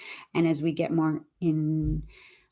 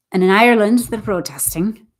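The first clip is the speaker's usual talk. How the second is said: amateur, lilting Irish brogue; attempted Irish brogue; Irish accent; 4 semitones higher, 6 dB louder than usual. Shown as a demonstration. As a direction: have a go at an Irish accent, a little bit. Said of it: "I could put on an Irish accent".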